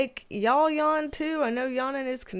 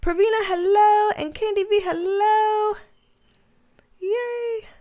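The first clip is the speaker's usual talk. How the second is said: higher, louder, bubbly hello; excited greeting; 9 semitones higher, 5 dB louder than usual. Real, not acted. Host welcoming new viewers to the live stream, excited and high. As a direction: greet them like you're thrilled they came.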